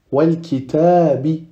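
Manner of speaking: slow wal kitabi with clear L; lam pronounced. Shown as a demonstration. Teacher repeating the lunar example, lam pronounced. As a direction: sound the lam before the kaf. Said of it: "on prononce le lam qui le précède".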